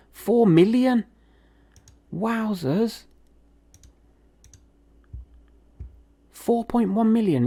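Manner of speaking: high-pitched disbelief at the offer; astonished by the big money; amazed. Real, not acted. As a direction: exclaim it, pitch way up.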